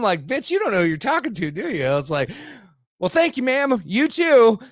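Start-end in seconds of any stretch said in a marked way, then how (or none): 0.3-1.8 mock indignant retort. Acts out his retort to her. 3.0-4.6 high, loud, sarcastically polite; mock-cheerful thanks. Passer-by answering a woman's curse, high mock-polite holler.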